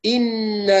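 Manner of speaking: إن with a clear short kasra on the hamza; correct kasra hamza. Shown as a demonstration. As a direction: say إن with kasra, not a long aa. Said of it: "بكسر الهمزة".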